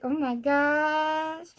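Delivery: slow, drawn-out gasp of realization; awed oh my gosh. Retold or imitated. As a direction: stretch it out like it just clicked. Friends realizing they like each other.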